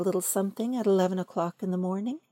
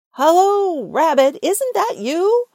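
loud, higher hopeful call; calling into the hole; 8 semitones higher, 11 dB louder than usual. Acted. Pooh calls loudly down Rabbit's hole. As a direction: shout down the hole to find a friend.

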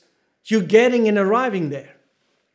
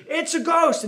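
mock scream; 6 semitones higher than usual. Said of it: "twelve grown men screaming".

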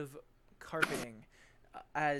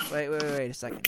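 usual speaking voice

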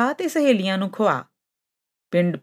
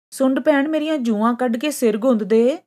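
high-pitched storybook cat voice; pleading cat; 7 semitones higher than usual. A narrator's reading. Cat asks Sund to groom her, high pleading voice. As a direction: ask sweetly, pitched well up.

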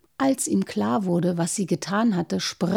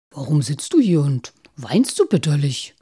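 wolf voice; 6 semitones lower than usual. Acted. Wolf asks princess.

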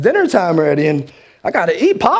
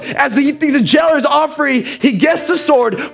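preaching at full force; 8 semitones higher than usual. Acted. Preacher to his church, retelling the jailer's night, preaching hard. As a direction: drive the story home to the congregation.

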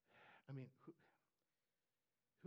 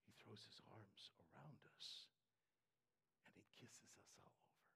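hushed and tender, far softer; tender whisper; 7 semitones lower, 13 dB quieter than usual. Real, not acted. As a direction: whisper it like a secret of love. Tender climax, dropped to whisper.

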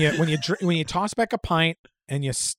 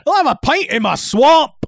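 high, loud Scottish ogre shout; Scottish ogre impression, loud and high; Shrek; 10 semitones higher, 7 dB louder than usual. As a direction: holler it in a hammy Scottish brogue. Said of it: "I like to say it's Shrek".

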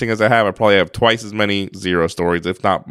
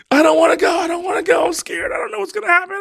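put-on much higher, panicky kid crying out; scared child; faster than usual. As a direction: imitate a terrified son refusing to go. Son panics in the harness.

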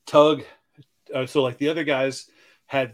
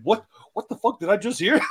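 higher, shouted disbelief; shocked retort; 6 semitones higher, 3 dB louder, faster than usual. Retold or imitated. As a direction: blurt it out in stunned outrage. Lance quoted in shock.

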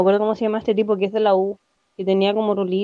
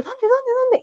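much higher, rapid eager "dónde"; mock a starstruck girl hunting for her crush; frantic excited searching; 14 semitones higher, slower than usual. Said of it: "y yo". (búsqueda frenética y emocionada, mucho más aguda)

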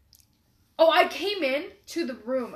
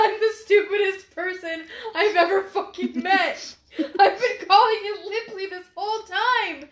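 higher-pitched imitation, cracking up; laughing mock confession; 7 semitones higher, 5 dB louder than usual. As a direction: wail the realization through laughter. Acts sister's confession, laughing.

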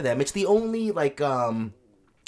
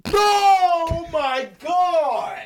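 drawn-out shocked shout, much higher and louder; shocked cry; 10 semitones higher, 6 dB louder, much slower than usual. Real, not acted. Cohost reacts to a prison joke with a shocked cry. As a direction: let the shock burst out long and loud.

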